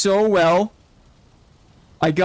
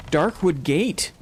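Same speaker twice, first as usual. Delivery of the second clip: ordinary talk, nothing marked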